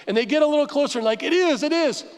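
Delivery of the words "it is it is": high-pitched excited confirmation; acted thrill of discovery; excited realization. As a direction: cry it higher as the hope comes true. Acting out leaders spotting water.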